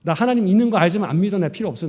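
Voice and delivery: reenacted stubborn outburst; defiant. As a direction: throw it at God in protest. Acts out past defiance toward God.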